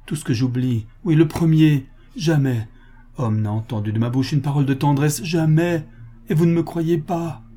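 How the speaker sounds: distraught woman, emphatic on her denials; agitated woman. Acted. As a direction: plead to be believed, building in insistence. Woman character makes agitated confession.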